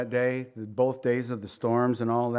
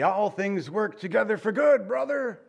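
mock pious cheer; 6 semitones higher than usual. Mimicking shallow Christians' breezy slogan.

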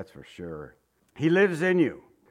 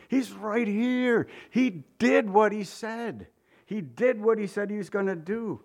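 joyful wonder; 7 semitones higher than usual. Acted. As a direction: let Mary's joy lift the pitch, drawing words out. Mary's joy at the risen Jesus.

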